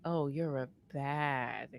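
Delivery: slow, drawn-out, lightly voiced flirty tease; imitating the professor. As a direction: drag it out like a smug professor. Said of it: "he said it like that".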